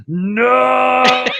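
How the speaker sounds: long, drawn-out high loud "no"; hammed-up slow-mo cry of horror; slow-motion scream. Player acting horror at seeing his ally fail, slow-motion scream. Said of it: "in slow motion looking at him".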